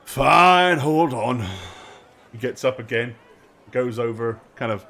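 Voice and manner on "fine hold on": exasperated guard. Acted. Guard summoned yet again.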